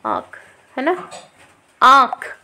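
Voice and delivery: आँख with a long nasal आँ; long aa demo. Shown as a demonstration. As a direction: draw out the आँ in आँख. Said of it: "आँख में बड़ी आवाज़ आ रही है". (आँख में लंबा आँ)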